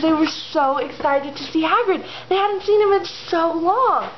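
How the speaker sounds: high, gushing storytelling; excited. A narrator's reading. Narrator sharing the girls' joy at seeing Hagrid, excited. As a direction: tell it with high-pitched fangirl excitement.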